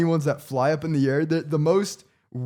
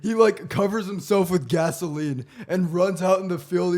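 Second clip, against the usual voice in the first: normal talking voice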